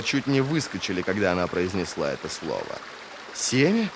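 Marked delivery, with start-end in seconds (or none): none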